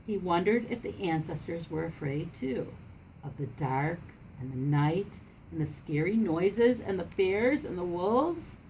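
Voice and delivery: usual speaking voice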